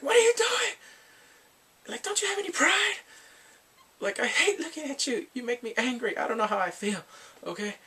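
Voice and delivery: high-pitched, exasperated yelling, easing off; acted angry scolding. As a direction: open with a mock shout, then let it subside. Acts out man scolding her.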